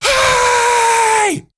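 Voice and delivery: sudden high, loud shout across the room; teacher yelling. Retold or imitated. Teacher yells across the lunchroom, loud high shout. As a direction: bark it to silence the whole cafeteria. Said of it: "she stands up and yells".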